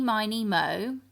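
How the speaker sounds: plain reading voice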